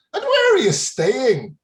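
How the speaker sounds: loud, high-pitched mock Irish lilt; terrible Irish accent. Tea-room woman asking tourists about lodgings, terrible mock Irish accent. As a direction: ham up an inquisitive Irish barmaid. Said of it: "forgive the accent, I know it's terrible".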